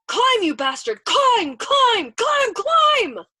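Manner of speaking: high, loud screamed command; frantic shout. A narrator's reading. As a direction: scream the order, pitch climbing with each repeat. Desperate order to pilot mid-flight.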